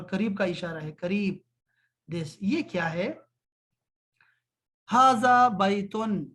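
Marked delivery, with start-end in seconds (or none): 4.9-6.2 slow, louder, every syllable laid out; teacher modelling the Arabic so pupils can copy it; slow model phrase. Shown as a demonstration. Teacher modelling Arabic for students, slow and loud. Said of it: "عربی میں نا".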